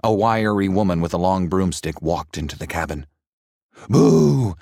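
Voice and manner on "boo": loud booming boo; spooky yell. A narrator's reading. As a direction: jump out and scare them. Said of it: "she yelled".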